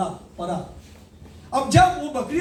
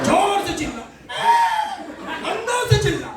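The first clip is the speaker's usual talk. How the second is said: urging shout; 9 semitones higher than usual. Retold or imitated. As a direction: push him to roar from inside, with a laugh. Lion urging cub to roar. (हँसते हुए ऊँची आवाज़ में ललकार)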